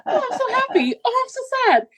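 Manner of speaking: high, laughing swings between joy and despair; mock emotional whiplash. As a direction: gush and panic in turns, half laughing. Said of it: "I would be like".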